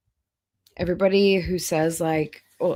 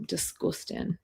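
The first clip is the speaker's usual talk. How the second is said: whispered disgust; 11 dB quieter than usual. Real, not acted. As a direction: breathe it out in disgust. Reacting to chewing on stream.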